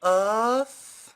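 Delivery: long slow belted oof; slow ecstatic yell; loud drawn-out cry. Acted. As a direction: stretch the shout out to the crowd. Performer hypes crowd.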